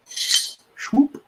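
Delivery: high, airy "swoop"; quick whooshed sound of a sliding blade; swoop sound effect. Shown as a demonstration. Knife reviewer showing how the blade just goes, swoop sound effect.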